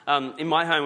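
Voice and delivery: normal talking voice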